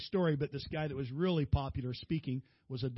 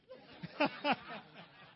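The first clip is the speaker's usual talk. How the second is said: laughing; 9 semitones higher than usual. Real, not acted. Laugh after the punchline.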